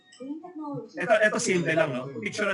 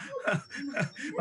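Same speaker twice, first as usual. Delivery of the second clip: laughing; 10 semitones higher, 8 dB quieter than usual. Real, not acted. Audience answering joke.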